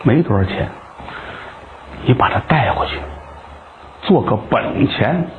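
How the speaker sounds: put-on low, soft young woman offering money; tender low girl's voice; gentle courtesan. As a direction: hush it, coax him kindly.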